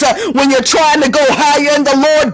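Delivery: rhythmic preacher's call; building sermon refrain; preaching refrain. Acted. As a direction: drive the refrain home like a sermon.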